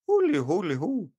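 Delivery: high, hammed-up Southern Irish accent; mock Southern Irish. Showing off his bad Southern Irish accent to his brother. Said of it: "I can do Southern as well".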